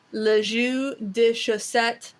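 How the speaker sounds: slow careful French, lɪ ʒy də ʃosɛt; French phrase. Shown as a demonstration. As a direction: say le jus de chaussette slowly in French. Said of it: "the French phrase".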